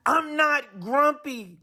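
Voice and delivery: grumpy monkey insisting. A narrator's reading. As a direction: dig in and deny it. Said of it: "Jim insisted".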